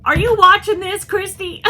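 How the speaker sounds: high, loud delighted call-out; excited call. Real, not acted. Calling to Christy over the costume, high excited call. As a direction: call out to a friend, thrilled.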